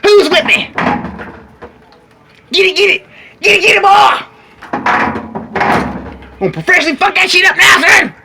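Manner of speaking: rally the troops, fired up; roaring rallying speech. Acted. Rallying the viewers.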